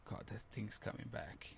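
whispered dread that the illness returns; fearful inner whisper. Retold or imitated. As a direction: breathe it out like a frightened thought. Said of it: "you feel like".

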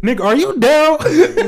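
slow, laughing question. Real, not acted. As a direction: ask it while cracking up. Laughing at the story.

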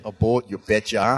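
hammed-up Minnesota accent. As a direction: play the cheery Minnesotan local. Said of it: "I'm trying to sound like I'm from Minnesota".

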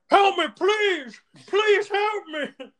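drawn-out, very high mock cries; hammed-up begging in a high voice; mock pleading wail. Joe choked by a kid, mock pleading wail.

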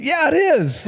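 high, teasing yeah it is; mock-insistent; playful insistence. Real, not acted. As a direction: answer the doubters with a grin. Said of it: "insisting to the doubters".

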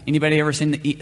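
ordinary delivery

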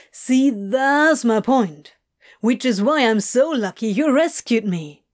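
drowsy, teasing Crowley; loving exasperation. A narrator's reading.

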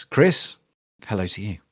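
usual speaking voice

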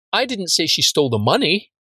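"money" at the end punched and lifted high; stress on MONEY. Shown as a demonstration. Trainer showing one different word emphasized, stress on "money". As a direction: save the weight for the final word "money". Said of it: "you emphasize one different word".

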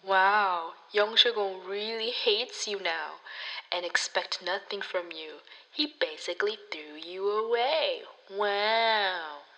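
soft, breathy villain's taunt; mock taunting. Evil friend delivering a cruel message to Pan Yu, taunting softly. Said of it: "comes to taunt Pan Yu".

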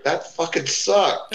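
high, slow disgusted grumble; retold young wrestler disgusted with his own promo; exasperated complaint. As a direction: drag it out, fed up with yourself. Frustrated wrestler on promo take.

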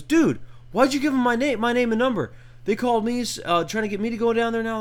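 high, loud upset buddy voice; friend Jackson, upset. Retold or imitated. Imitating friend Jackson complaining on the phone. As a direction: whine it out like an annoyed friend. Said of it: "He goes".

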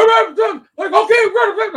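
high, loud mock coaching yell; imitated coach's voice. Coach heard through the door, shouting high and loud. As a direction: holler it like a coach running drills. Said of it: "I hear this voice".

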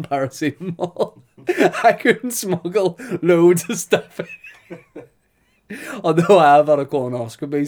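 laughing through it. Real, not acted. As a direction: crack up while you confess. Laughing at own joke.

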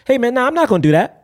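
high-pitched, non-threatening refusal. Shown as a demonstration. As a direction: lift the voice well up to sound harmless. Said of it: "It sounds safer".

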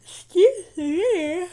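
slow, strained after choking; recovering from choking. Real, not acted. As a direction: clear the throat and recover. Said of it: "went to talk and swallow".

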